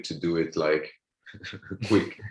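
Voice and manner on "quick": ordinary talk, nothing marked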